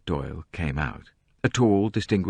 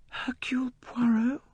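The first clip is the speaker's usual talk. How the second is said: breathy startled whisper; hushed and high, caught off guard; struck whisper; 4 dB quieter than usual. A narrator's reading. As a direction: whisper the name, taken aback. Lynette struck by the name.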